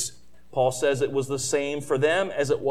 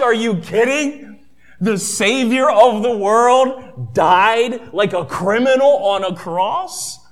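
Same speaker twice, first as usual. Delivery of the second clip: scoffing disbelief; 5 semitones higher, 6 dB louder than usual. Retold or imitated. Greeks mocking the cross message.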